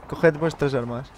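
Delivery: normal talking voice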